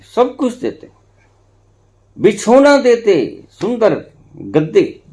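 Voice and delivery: lifted, slow listing. Acted. Listing comforts given to the prisoner.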